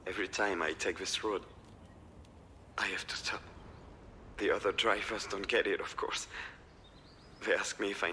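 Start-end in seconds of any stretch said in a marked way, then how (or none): 2.8-3.4 hushed, breathy aside; whisper. Real, not acted. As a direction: whisper it to yourself. Confiding about stopping there.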